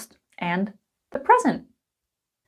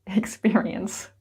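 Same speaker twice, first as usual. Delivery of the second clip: laughing whisper; 3 semitones lower, 5 dB quieter, slower than usual. Real, not acted. Explaining aside to viewers.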